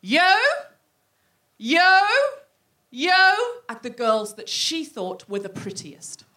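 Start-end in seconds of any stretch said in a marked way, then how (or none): 0.0-3.3 loud, high, separate calls with pauses; single out each one with a rising call; teacher picking pupils. Acted. Teacher pointing along a line of girls, selecting.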